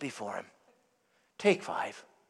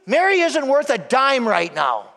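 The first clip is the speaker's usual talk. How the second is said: a touch of huffy indignation, pitched up on Jesus; mock indignant complaint; 6 semitones higher, 3 dB louder than usual. Martha complaining to Jesus about Mary, mildly indignant. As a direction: play Martha griping about her sister, lightly. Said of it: "and I quote".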